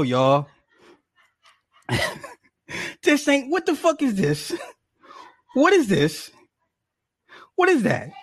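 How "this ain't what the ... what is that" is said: much higher, stunned outrage; can't believe what he's holding; outraged disbelief. Real, not acted. Husband staring at fake Jell-O, high disbelief.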